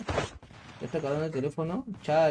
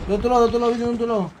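raised, high warning to teammates; loud repeated combat callout; urgent callout; 6 semitones higher, 6 dB louder, much faster than usual. Real, not acted. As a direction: call out the enemy's direction urgently.

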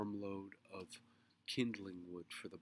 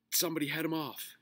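fast, loud, raised order; urgent directing; 9 semitones higher, 11 dB louder, faster than usual. A narrator's reading. Mister Grumby directing bystanders after the dog, urgent. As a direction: call out orders mid-chase. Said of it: "he directed".

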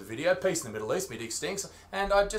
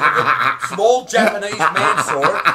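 ordinary talk

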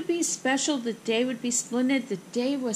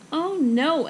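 loud, higher groan of dismay; 5 semitones higher, 6 dB louder than usual. Acted. Girl finds the park noisy, dismayed groan. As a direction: groan it out, voice raised. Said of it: "Ava groaned".

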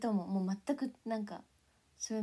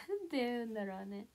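ordinary talk

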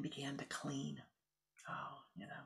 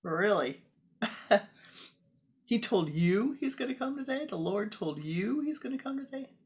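higher, louder incredulous taunting; mocking disbelief; 6 semitones higher, 7 dB louder than usual. Fellow Swamis ridiculing the young Swami's dream, mocking disbelief. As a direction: scoff at her as if she's lost it.

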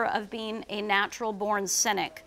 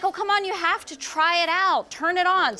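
higher, coaxing and excited; eager husband; 6 semitones higher than usual. Retold or imitated. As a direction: coax her to try the gift, all wound up. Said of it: "And he's like".

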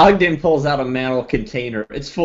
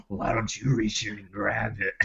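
low creepy voice; 3 semitones lower, 10 dB quieter than usual. Acted. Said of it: "He says".

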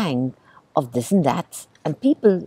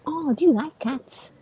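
usual speaking voice

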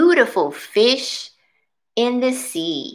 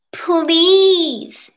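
little fish pleading; 7 semitones higher, 4 dB louder than usual. Acted. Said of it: "he said".

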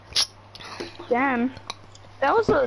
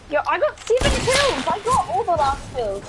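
excited brag; 6 semitones higher than usual. Real, not acted. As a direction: shout the brag to the squad. Bragging after big win.

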